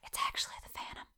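hushed dramatic whisper. Real, not acted. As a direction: whisper the spooky reveal.

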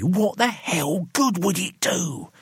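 high, drawn-out exasperation; heated, slow-paced challenge; exasperated retort. A narrator's reading. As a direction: throw the question back, slow and high. Gregory argues with Roy.

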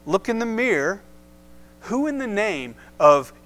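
mild mock southern accent; lightly voiced as a Southerner. Paul challenging the Corinthians, in a light southern accent. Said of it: "in his good southern accent".

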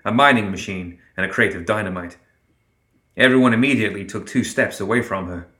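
usual speaking voice

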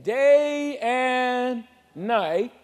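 very slow, drawn-out "Day and night"; stretched answer. Shown as a demonstration. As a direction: hold each word long. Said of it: "How often?".

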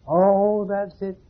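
a hammed-up aha; mock sudden realization. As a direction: act out the penny dropping. Sudden realization in acted quote.

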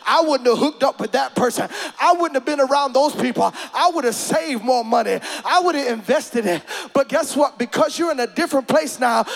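loud, fervent preacher cadence; preaching. Real, not acted. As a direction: preach it with rising fire. Prophetic preaching, music under.

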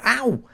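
sudden high mock "ow"; mock cry of pain. Passenger banging their head on the car door, mock yelp. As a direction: yelp as if bumped, in play. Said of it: "They go".

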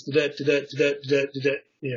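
"da-da" chanted quickly, da-DA da-DA, in a steady pulse; iambic beat. Shown as a demonstration. Counting out ten beats to the group, quick da-DA chant. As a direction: rattle off the "da-da" beats as a quick rhythm. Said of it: "it's five beats, or it's ten beats".

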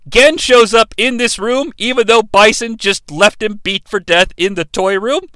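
normal talking voice

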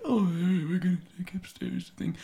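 thin, high, near-whispered mumble; frail and gentle. Acted. Mimicking the first Dumbledore, frail and quiet. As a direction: weakly murmur it as a frail old wizard. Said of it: "frail and quiet and gentle".

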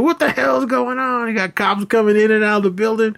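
high, far-off put-on voice of a nosy landlady; bursting-in landlady. Landlady Miss Pearly bursts in yelling.

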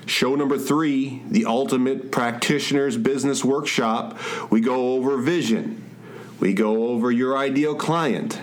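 upbeat podcast intro read; host voice, bright and lifted; announcer. Acted.

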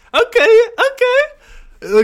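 high, loud, drawn-out okay; excited okay. Real, not acted. Streamer reacting to a sex tip, excited squeal.

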